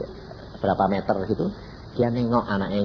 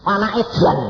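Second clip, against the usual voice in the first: raised, higher scolding voice; angry father; 9 semitones higher, 5 dB louder than usual. Retold or imitated. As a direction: scold like an outraged father, loud. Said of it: "Papa marah". (bentakan ayah yang marah)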